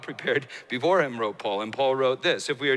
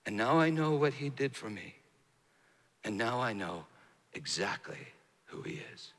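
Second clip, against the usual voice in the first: low and soft, sinking to a whisper; quiet awe with long pauses; hushed realisation, fading; 7 semitones lower, 8 dB quieter than usual. Retold or imitated. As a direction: let the quoted confession fade away into breath. Monk's realisation, fading to near whisper.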